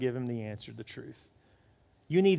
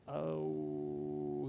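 caught-out oh; 11 semitones lower, 10 dB quieter than usual. Retold or imitated. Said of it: "And he'll say to me".

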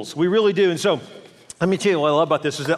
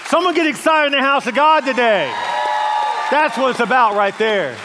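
loud, raised rally cry to the congregation; rousing call; 5 semitones higher, 5 dB louder than usual. Real, not acted. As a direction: call out to fire up the crowd. Rallying the congregation to get excited.

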